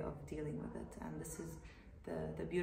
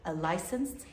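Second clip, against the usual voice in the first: YouTube host intro; 11 dB louder than usual. Acted. Host opening a YouTube video for viewers, presenter greeting. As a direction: greet the viewers at full volume, pitched up at the start.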